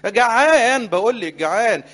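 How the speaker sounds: mock-loud hungry yell; whining hungry boy. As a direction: demand food like a spoiled child. Boy voiced insisting he's hungry.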